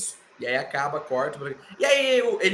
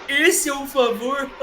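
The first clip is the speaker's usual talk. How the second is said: slow, higher mocking question; 8 semitones higher, 3 dB louder, slower than usual. Roman mocking Punk's favor, slow and scornful. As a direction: sneer it out slowly like a smug boss.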